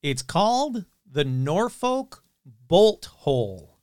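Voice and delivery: slow, every syllable crisp and separate; over enunciating. Shown as a demonstration. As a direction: spell out Norfolk Bolt Hole carefully and slowly. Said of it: "I have to enunciate very clearly".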